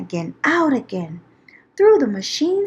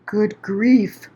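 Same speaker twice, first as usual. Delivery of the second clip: groaning; 3 semitones lower than usual. Acted. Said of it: "groaned the ones".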